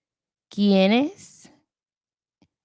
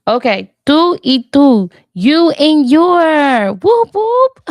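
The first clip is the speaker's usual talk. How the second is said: loud, higher celebration, rising to high whoops; triumphant; 6 semitones higher, 11 dB louder than usual. Real, not acted. Learner celebrating that the two words checked out, triumphant. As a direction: belt it out, ending in high whoops.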